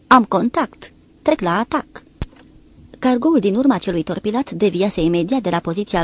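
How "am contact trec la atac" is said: crisp impersonal report over the radio; impersonal radio call. A narrator's reading. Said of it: "o voce impersonală anunțând pe unde ultrascurte". (voce impersonală, ca la stație)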